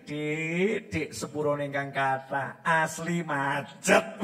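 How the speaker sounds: low, soft pleading; apologetic husband. Acted. Husband apologises to wife over traffic, softly pleading. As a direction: coax her gently with an apology.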